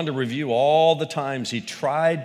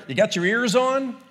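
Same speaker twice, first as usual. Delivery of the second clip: trucker on the CB; 10 semitones higher, 5 dB louder than usual. Retold or imitated. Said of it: "the trucker on the highway who says".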